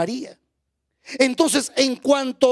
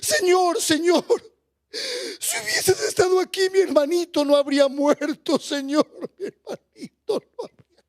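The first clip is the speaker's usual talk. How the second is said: high-pitched, hammed-up wail of grief; imitated weeping; 6 semitones higher than usual. Preacher voices María crying to Jesus over her brother, imitated weeping. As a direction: act out a woman sobbing through her plea. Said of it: "le dice llorando, llorando, le dice".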